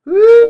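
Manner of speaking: sudden high whoop; startled woo at a jolt; surprised whoop. Real, not acted. Camera shakes.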